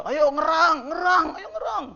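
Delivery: high, insistent cheerleading call; motherly urging. Retold or imitated. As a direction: spur them on to groan, high. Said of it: "Bunda Kristen juga".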